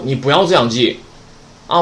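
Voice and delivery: ordinary talk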